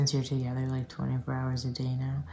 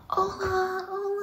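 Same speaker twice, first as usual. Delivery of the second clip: high-pitched imitation of a scream; thin, high mock yell. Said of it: "he is screaming".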